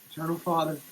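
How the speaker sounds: usual speaking voice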